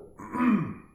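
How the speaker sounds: loud rough throat clear. Real, not acted. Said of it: "a little frog in my throat".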